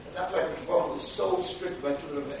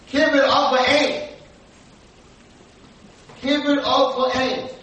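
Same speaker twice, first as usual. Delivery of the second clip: loud, high, slow declaration; emphatic proclamation; 8 semitones higher, 8 dB louder, slower than usual. Real, not acted. Preacher telling girls the key commandment, emphatic and loud. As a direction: proclaim each word slowly and forcefully.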